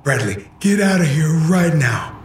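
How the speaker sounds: high, strained angry shout; angry father. Acted. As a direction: bark the order like a furious dad. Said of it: "He was angry".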